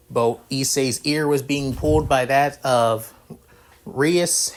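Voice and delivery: ordinary delivery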